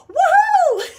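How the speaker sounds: a very high, loud whooping cheer through laughter; laughing whoop. Real, not acted. Cracking herself up in front of her viewers, real laughing whoop. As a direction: whoop with giddy glee.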